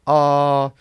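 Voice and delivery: high, level sustained "ah"; first tone. Shown as a demonstration. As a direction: sing out "ah" straight and high, no rise or fall. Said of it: "you just sing out a straight tone".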